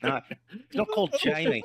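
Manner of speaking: laughing mock Australian twang; Australian accent. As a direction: say it like a cheeky Aussie. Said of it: "That's Australian".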